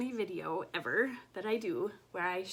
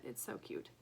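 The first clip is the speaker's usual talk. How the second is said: whispering; 10 semitones lower, 8 dB quieter than usual. Real, not acted. Cooing over a rabbit pattern.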